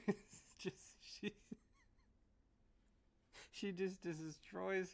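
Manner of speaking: can't hold back the laugh. Real, not acted. Bursts out laughing at the joke.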